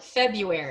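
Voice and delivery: "February" as FEB-yoo-air-ee; pronunciation without R. Shown as a demonstration. Teacher models a hard word, R dropped after B. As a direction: drop the first R in "February", say "yoo" instead. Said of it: "act like it has no R".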